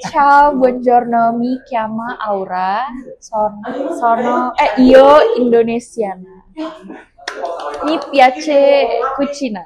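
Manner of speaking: slow careful Italian phrases; speaking Italian. Shown as a demonstration. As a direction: recite beginner Italian slowly. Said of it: "gue bisa itu doang". (bahasa Itali pelan-pelan)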